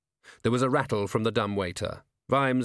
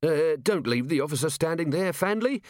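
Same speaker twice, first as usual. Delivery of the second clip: higher, jittery order to a workman; nervous voice; 7 semitones higher than usual. A narrator's reading. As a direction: pitch it up, uneasily polite. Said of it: "said a nervous voice".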